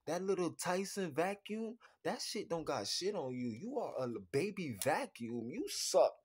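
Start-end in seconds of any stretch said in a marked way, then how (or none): none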